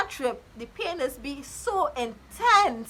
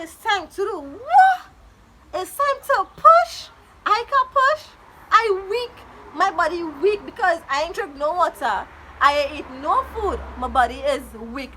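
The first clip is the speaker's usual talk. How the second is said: panicked loud disbelief; 5 semitones higher, 5 dB louder than usual. Retold or imitated. To the nurse who says push, a high loud panicked cry. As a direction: shout back that you are too weak to push. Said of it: "I say".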